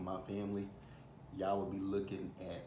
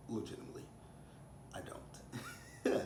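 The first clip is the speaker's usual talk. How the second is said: whispered aside; nearly voiceless, confiding; hushed insistence; 6 dB quieter than usual. Real, not acted. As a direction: drop to a whisper to underline it. Emphatic aside, voice drops away.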